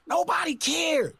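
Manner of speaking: normal talking voice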